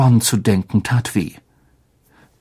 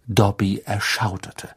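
usual speaking voice

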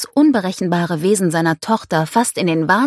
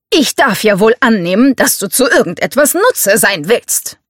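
louder, raised irritated retort; growled; 5 semitones higher, 6 dB louder than usual. A narrator's reading. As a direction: snap back like an affronted patriarch. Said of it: "knurrte Bowman".

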